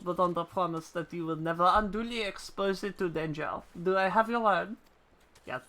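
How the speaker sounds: put-on French-accented game character; bad French accent. Ishgardian instructor teaching a player about chocobos, bad French accent. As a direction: read it in a hammy French accent. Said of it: "All the Ishgardens get bad French accents".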